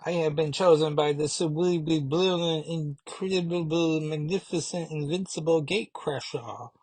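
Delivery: pompous villain who can't say her R's; Gatecrasher's lisping boast. Retold or imitated. Gatecrasher's minion boasts grandly, R's as W's. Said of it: "all the R's are W's".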